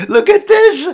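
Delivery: high, loud hooting call; laughing bystander. Acted. Bystanders enjoy the exciting chase, loud laughing call.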